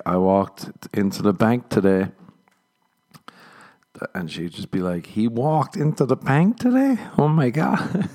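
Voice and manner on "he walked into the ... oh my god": high-pitched mock-incredulous repeat. Woman in crowd repeats the comedian's line, incredulous. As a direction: imitate an astonished audience member, voice shooting up. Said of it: "just repeating stuff, like, incredulously".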